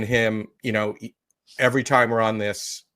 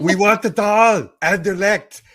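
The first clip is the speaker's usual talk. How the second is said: loud, higher, laughing mock French-Austrian accent; 9 semitones higher, 8 dB louder than usual. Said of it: "a mix of [name] and [name]".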